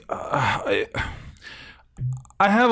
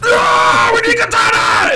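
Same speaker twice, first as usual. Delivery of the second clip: much higher, louder panicked shout; mock executive shout; 13 semitones higher, 5 dB louder, slower than usual. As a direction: yell it like a frantic studio executive. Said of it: "some executive came in and was like".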